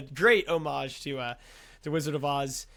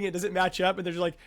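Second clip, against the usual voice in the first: ordinary talk, nothing marked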